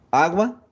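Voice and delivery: "agua" with a high, lifted first A; stress on first A. Shown as a demonstration. Teacher showing which vowel is tonic, stressed first A. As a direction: hit the A of "agua" and hold it up. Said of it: "es la sílaba tónica".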